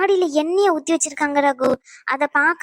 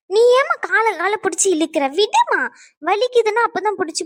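high, sudden pained outburst; pained protest; 4 semitones higher than usual. Acted. Injured woman to person pulling her leg, pained annoyance. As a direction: protest sharply as the sore leg is tugged.

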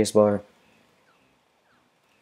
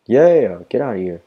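excited shout; 4 semitones higher, 7 dB louder than usual. Real, not acted. Shooting enemies in game.

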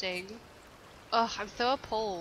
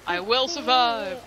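1 loud declaration; 2 semitones higher, 9 dB louder than usual. Real, not acted. Declaring survival at screen.

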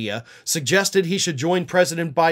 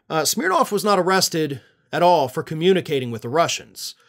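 ordinary talk, nothing marked

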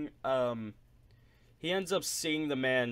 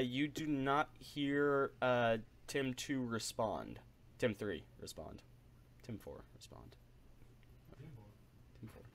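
fading out; 9 dB quieter than usual. Acted. GM fades out jokingly.

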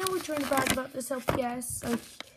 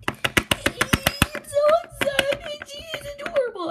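high, drawn-out excited squeal; yelling excitement; 12 semitones higher, 6 dB louder, slower than usual. Real, not acted. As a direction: squeal it out with joy. Squealing over rare toy.

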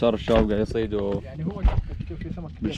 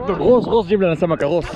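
egging on; 6 semitones higher than usual. Real, not acted. Urging friend to dive.

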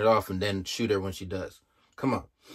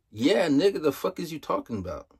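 incredulous scoff; 7 semitones higher than usual. Real, not acted. Mocking retort to cops.

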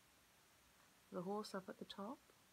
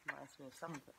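ordinary delivery

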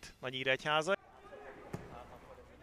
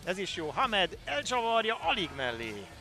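excited sports call; 6 semitones higher, 6 dB louder than usual. Real, not acted. Shot called in commentary.

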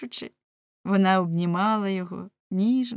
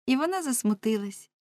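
ordinary delivery, nothing marked

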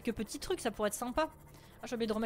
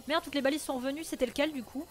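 ordinary talk

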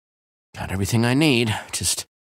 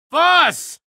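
shout; 5 dB louder than usual. Acted. Calling out on arrival.

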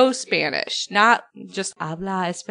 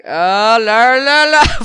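louder, higher mock "la, la, la"; imitating her sing-song; 4 semitones higher, 10 dB louder, much slower than usual. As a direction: lightly mimic the housekeeper's chatter. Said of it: "in that, like, ... voice".

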